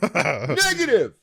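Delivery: slow, stretched "Negative!"; hammed-up slow soldier call; drawn-out mock shout. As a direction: drag the word out like a soldier. Repeats the mock soldier reply.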